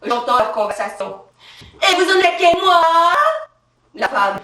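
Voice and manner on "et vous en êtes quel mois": loud, high-pitched questioning nurse; nosy nurse. Acted. Nurse asking a pregnant woman her month, loud and high. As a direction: pipe up loudly as the nurse.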